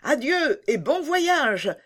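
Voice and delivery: slow, high, drawn-out goodbye; tearful farewell cry. A narrator's reading. Prince and princess bid Gerda farewell, slow high cry. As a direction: wave them off with a long high farewell. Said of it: "crièrent le prince et la princesse".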